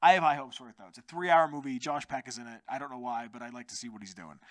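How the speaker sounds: usual speaking voice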